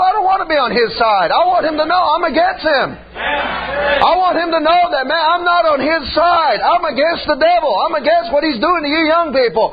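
shouted, high preaching; fiery pulpit shout to young people; preaching at full force. Acted. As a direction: preach it hard against the devil. Preaching climax against the devil.

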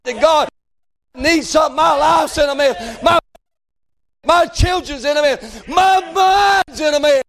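plea climbing to a high, stretched cry; crying out to God. Acted. Preacher acting out someone begging God for help, crying out. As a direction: build the plea phrase by phrase. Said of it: "too high class to cry out".

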